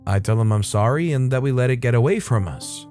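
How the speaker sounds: usual speaking voice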